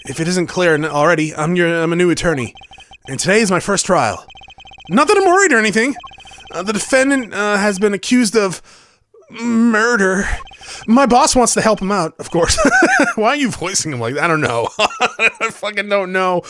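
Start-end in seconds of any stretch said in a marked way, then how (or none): 0.1-12.6 higher, jittery rookie voice; nervous young lawyer. Acted. Voices Apollo. 12.6-13.1 high, sudden laugh; burst of laughter. Real, not acted. Laughs at own voicing.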